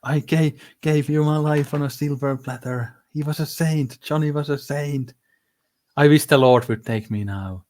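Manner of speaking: a self-pitying old mother, mimicked; mock Livia. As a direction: play the martyred mother.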